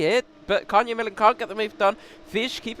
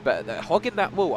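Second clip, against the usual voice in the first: ordinary talk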